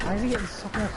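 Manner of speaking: breathy whisper, barely voiced; whispered aside. Voicing the cashier's silent thought in a whisper.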